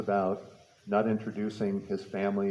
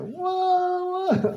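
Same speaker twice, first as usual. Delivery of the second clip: high imitating wail of a crying kid; high mock sobbing whoa; 5 dB louder than usual. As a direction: squeal it like a sobbing seven-year-old. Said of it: "he's, like, crying hysterically".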